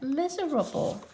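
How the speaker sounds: plain reading voice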